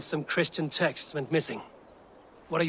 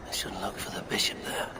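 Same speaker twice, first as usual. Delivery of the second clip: whispering; 6 semitones lower, 5 dB quieter than usual. Acted. Alone at the quiet Rectory.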